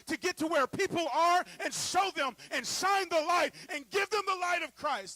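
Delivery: loud, high, driving sermon climax; rally the church, full volume; preaching at full force. Acted.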